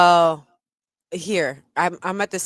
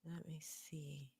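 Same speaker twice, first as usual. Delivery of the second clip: whisper; 27 dB quieter than usual. Real, not acted. Muttering to himself at screen.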